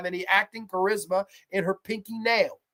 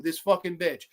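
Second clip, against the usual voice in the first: ordinary talk, nothing marked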